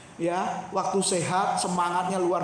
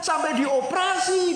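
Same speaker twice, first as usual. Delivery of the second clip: shout; 8 semitones higher, 4 dB louder than usual. Real, not acted. Preacher's emphatic shout.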